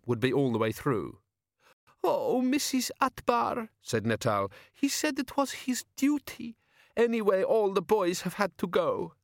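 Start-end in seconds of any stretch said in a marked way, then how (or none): 2.0-9.2 put-on high tearful young woman; young woman Natal. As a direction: plead gently as the young woman.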